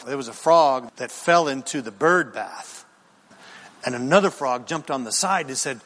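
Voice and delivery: usual speaking voice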